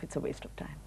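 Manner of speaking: soft dismissive whisper. Real, not acted. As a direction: toss it off under breath. Dismissive aside.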